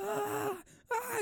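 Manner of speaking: mock scream. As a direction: squeal a fake scream. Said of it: "just screaming".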